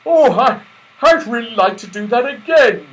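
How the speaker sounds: high, loud longing; eager Santa. A narrator's reading. Santa wanting to slide again, jolly eagerness. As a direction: beg for another go. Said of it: "said Santa Claus".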